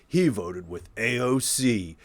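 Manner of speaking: slow attack-ad voiceover, ending loud; political attack ad. Retold or imitated. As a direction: read it like a campaign ad announcer. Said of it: "You should do political ad voiceover".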